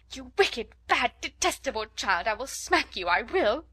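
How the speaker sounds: loud, exasperated outburst; helpless, excited scolding. A narrator's reading. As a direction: lose patience and threaten the howling child. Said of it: "so helplessly and excitedly".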